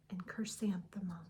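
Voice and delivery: soft, unvoiced whisper of the name. A narrator's reading. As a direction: let the name fall to a hush. Roll call ends on her name.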